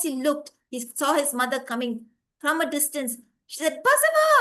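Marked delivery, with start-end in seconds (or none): 3.8-4.3 high, loud mother's call; calling from afar. Acted. Mother calls her son from a distance, high loud call. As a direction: call out a child's name across a distance.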